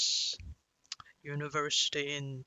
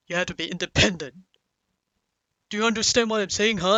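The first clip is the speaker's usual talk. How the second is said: loud, rapid-fire lecture, rising higher; scolding father; 5 semitones higher, 9 dB louder, much faster than usual. Retold or imitated. Father demanding his kid be independent, scolding. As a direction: imitate a parent drilling in advice. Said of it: "he's starting to say".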